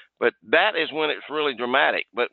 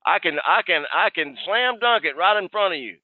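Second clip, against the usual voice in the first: hyped-up greeting; 6 semitones higher than usual. Retold or imitated. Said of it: "had the guy laughing".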